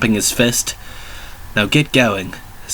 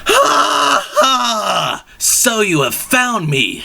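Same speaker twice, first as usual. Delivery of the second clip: high, slow gloating villain; villain's taunt; 11 semitones higher, much slower than usual. Acted. Titus greets the heroes below Big Ben, high gloating villain. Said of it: "shouted Titus".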